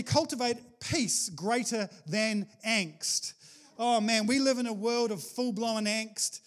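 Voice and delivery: normal talking voice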